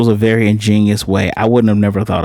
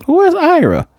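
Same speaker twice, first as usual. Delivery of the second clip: high squeak. Retold or imitated. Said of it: "then you go".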